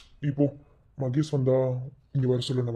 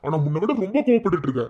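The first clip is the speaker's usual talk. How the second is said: usual speaking voice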